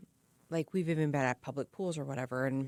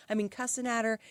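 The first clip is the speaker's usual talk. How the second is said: normal talking voice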